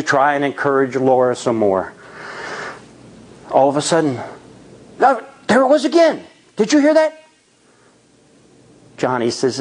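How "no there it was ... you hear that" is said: higher, urgent and startled; tense, straining to hear; alarmed. A narrator's reading. Laura hearing the noise again in the dark, higher and alarmed.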